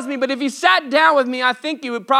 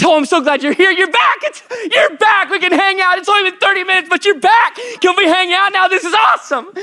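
higher, giddy voice of an overjoyed dog; excited dog voice; 9 semitones higher, 5 dB louder than usual. Retold or imitated. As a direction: bounce through it like a thrilled puppy. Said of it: "My dog".

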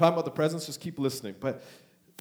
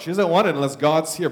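ordinary talk, nothing marked

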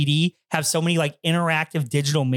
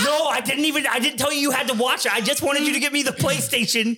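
high-pitched retold backpedalling; defensive protest; 8 semitones higher than usual. Storyteller to the coworker sent for the PlayStation, defensive protest. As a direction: replay yourself protesting, voice pushed well up.

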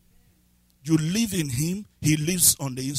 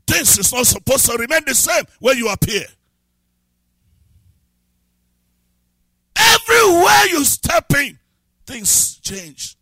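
full-force preaching; 7 semitones higher, 10 dB louder than usual. Acted. Preacher proclaiming life over death to the church, shouting. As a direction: thunder it out over the congregation.

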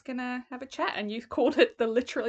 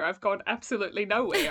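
ordinary delivery

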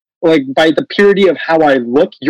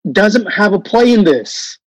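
higher, halting imitation of a director; imitated director; 5 semitones higher than usual. Director telling the cast race doesn't matter, hesitant mock explanation. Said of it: "all of a sudden the director's like".

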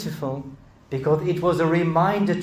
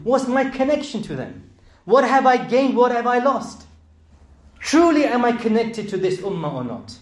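higher, louder rising sermon voice; impassioned preaching; 6 semitones higher, 4 dB louder than usual. Acted. Preacher pleading with congregation, rising to loud passion. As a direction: build the plea to the congregation. Preacher building to full force.

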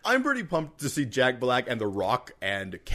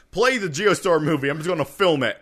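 laughing, loud and playful; 4 semitones higher, 6 dB louder than usual. Pretend. Mock theater pirate.

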